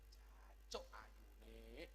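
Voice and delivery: slow, low, drawn-out amazement; admiring awe. Shown as a demonstration. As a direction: savor it in awe. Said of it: "ungkapan kekaguman karena takjub". (kagum, pelan dan rendah)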